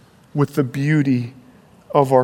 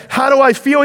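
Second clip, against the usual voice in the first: much higher and louder, bursting with joy; joyful cry; 10 semitones higher, 4 dB louder than usual. Acted. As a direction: shout it like a hobbit who just woke up happy. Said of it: "he cried".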